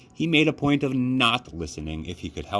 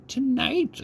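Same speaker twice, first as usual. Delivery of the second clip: put-on eerie old woman. A narrator's reading. Grandmother promises a story.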